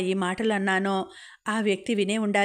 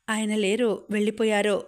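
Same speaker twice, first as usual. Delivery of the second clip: usual speaking voice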